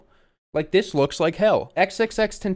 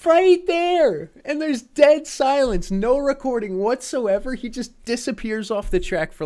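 outraged high rant; 10 semitones higher than usual. Real, not acted. Streamer blasting a song's bad splice, high outraged rant. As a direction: squeal your disbelief at the sloppy edit.

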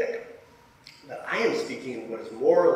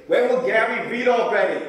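high, loud impatient shout; kids shouting; 9 semitones higher, 8 dB louder than usual. Retold or imitated. Children clamoring for Gary to read, loud and high. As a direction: yell it like a restless schoolkid. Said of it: "they will shout throughout the English period".

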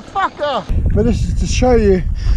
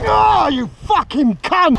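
angry curse; 7 semitones higher, 3 dB louder than usual. Real, not acted. Frustrated cursing on trail.

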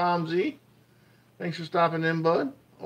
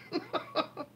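laughing; 8 dB quieter than usual. Real, not acted. Laughs at own joke.

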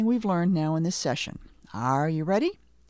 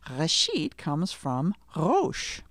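slow, careful explanation ending on Bereshit; slow teaching; much slower than usual. Shown as a demonstration. Teacher slowly explaining the Bible's first word. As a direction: spell it out slowly for beginners. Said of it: "slow teaching pace".

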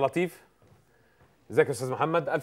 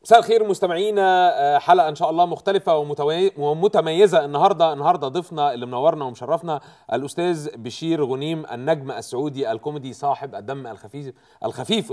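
louder upbeat on-air welcome; radio host voice; 5 dB louder than usual. Acted. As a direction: open the show like a radio host.

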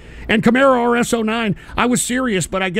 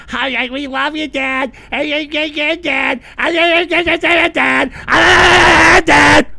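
a lightly put-on whiny chat voice, then yelling; mocking chatter voice; 7 semitones higher, 3 dB louder, slower than usual. Streamer mocking chat's "hey dad" messages, whiny mock voice. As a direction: mimic the annoying viewers, mildly. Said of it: "Every time you".

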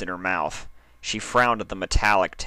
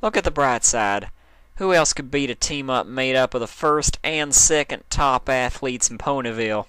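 smiling; 6 semitones higher than usual. Acted. Applejack teasing sulking Dash, smiling. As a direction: tease her out of the sulk with a smile.

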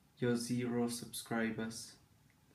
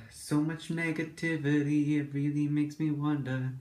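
louder complaint; 3 semitones higher, 5 dB louder than usual. Real, not acted. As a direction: say it louder, with exasperation. Complaining about negative comments.

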